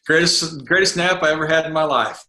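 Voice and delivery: chuckling at the memory of the nap; laughing. Real, not acted. SEAL laughing to the host about a nap in hell.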